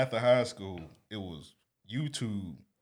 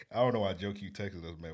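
ordinary talk, nothing marked

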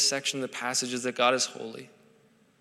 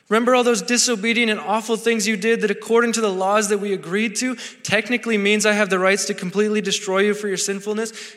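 put-on a higher, louder father calling his kids back; pleading father; 5 semitones higher, 4 dB louder than usual. God as a father begging the Israelites to remember him, higher and louder. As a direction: call out like a father reaching for his wayward children. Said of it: "God is this father trying to get their attention".

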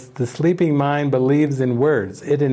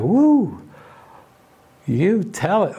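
excited whoop; 5 semitones higher, slower than usual. Retold or imitated. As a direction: cheer the song on from the heart. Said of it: "I was like".

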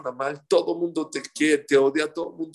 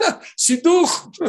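high, loud, stretched out delight; delighted exclamation; 12 semitones higher, 9 dB louder, much slower than usual. Retold or imitated. Shopkeeper hearing the driver needs fifteen meters, a stretched happy cry. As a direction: cry out happily at a perfect match.

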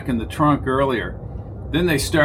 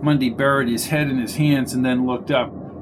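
usual speaking voice

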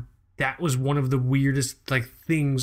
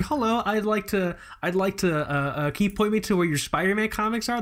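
higher, hesitant newbie voice; timid noob customer; 8 semitones higher than usual. Pretend. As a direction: ask like a nervous beginner. Pretends to be a clueless noob.

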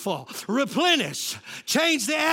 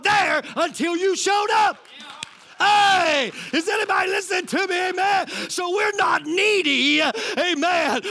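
higher-pitched, shouted sermon delivery; full-force preaching; 5 semitones higher than usual. Real, not acted. Preacher rousing listeners about not being needy, full-force preaching. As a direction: preach it hard to rouse the room.

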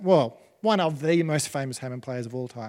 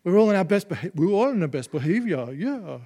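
badly attempted Liverpool accent, higher and louder; 3 semitones higher, 4 dB louder than usual. Preacher mimicking a Beatle in a documentary, clumsy Liverpool accent. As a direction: have a go at a Liverpool accent you admit you can't do. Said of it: "I can't do the [name] accent".

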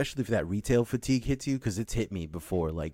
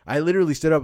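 suddenly louder, standing up angry; 6 semitones higher, 8 dB louder, faster than usual. Retold or imitated. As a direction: raise it as you get up. Storming out of interview.

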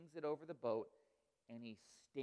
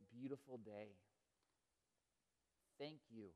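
prayer. Acted. Leads the closing prayer.

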